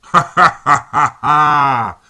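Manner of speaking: slow, loud, mocking villain laugh; a long cruel belly laugh; evil laughter. A narrator's reading. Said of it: "he laughed".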